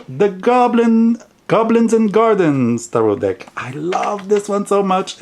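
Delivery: high-pitched, adoring squeal of words; gushing delight. Real, not acted. Vlogger reveals the deck that makes them smile, gushing delight. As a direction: gush over it in a high, delighted voice.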